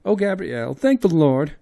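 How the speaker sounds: high, loud, tearful thanks; high wailing woman voice; relieved maid. Acted. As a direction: gasp out thanks to heaven. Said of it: "she said".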